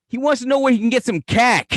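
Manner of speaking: high, loud Boston voice; thick Boston accent. Acted. Clerk telling Cindy what the customer wants, thick Boston accent. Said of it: "fucking Fenway Joe over here goes".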